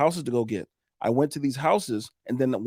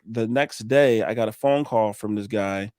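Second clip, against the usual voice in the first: ordinary talk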